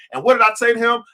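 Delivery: usual speaking voice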